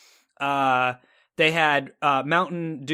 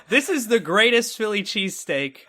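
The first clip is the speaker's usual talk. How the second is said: higher-pitched, emphatic rave; retold amazed proclamation; 6 semitones higher than usual. Man raving about his first bite, emphatic amazement.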